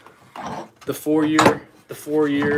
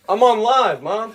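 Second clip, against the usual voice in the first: higher, louder call to someone off-room; calling out to mom; 7 semitones higher, 3 dB louder, slower than usual. Real, not acted. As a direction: raise the voice to reach mom in the next room. Calling out to his mother.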